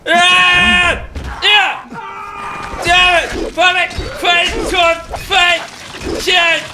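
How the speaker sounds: high, forceful bursts of shouting; combat yelling. Real, not acted. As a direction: yell with every blow. Yelling while fighting zombies.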